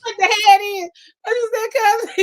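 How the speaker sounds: high, loud stunned shout; shocked shout. Real, not acted. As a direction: yell it in disbelief.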